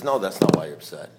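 imitating an unruffled sponsor; sponsor's verdict. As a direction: contradict flatly and low. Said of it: "He says".

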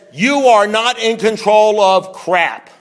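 loud, slow, hammered-out words; forceful, drawn-out pronouncement; emphatic declaration. Acted. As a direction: drive each word home like a blazing sign. Declaims the neon sign message.

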